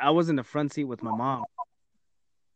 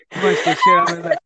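normal talking voice

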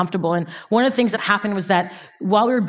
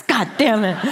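laughing curse; 3 semitones higher than usual. Retold or imitated. Called bluff, laughing.

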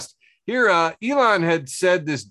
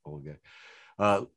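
normal talking voice

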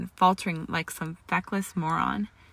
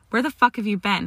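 louder, heated demand; angry demand; 3 semitones higher, 6 dB louder than usual. A narrator's reading. He rounds on Granger as she comes in late, demanding. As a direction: demand an answer, voice raised. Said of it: "he demanded".

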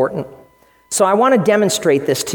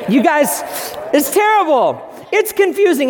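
mock dismay; 7 semitones higher than usual. Real, not acted. Joking about his own Portuguese.

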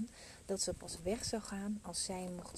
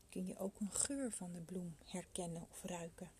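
soft spa whisper; 3 dB quieter than usual. Acted. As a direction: guide them gently into calm. Leads a meditation.

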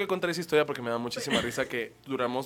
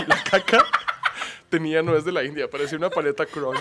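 words pushed out through laughter; telling it while cracking up; loud giggly retelling; laughing; 8 dB louder than usual. Real, not acted.

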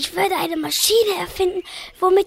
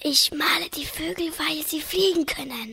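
whisper; 5 dB quieter than usual. Acted. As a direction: hush them without a sound. Painter whispering to the children.

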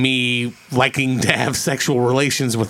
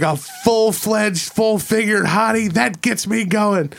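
slow, higher hammed-up declaration; savoring each word, slow and higher; reciting a movie line; 6 semitones higher, slower than usual. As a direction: drag it out like a cheesy movie hero. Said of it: "And he's like".

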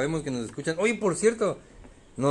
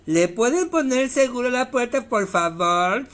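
high, loud furious demand; angry demand; 11 semitones higher, 6 dB louder than usual. Retold or imitated. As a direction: bark the request, enraged. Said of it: "dice, encabronado ya".

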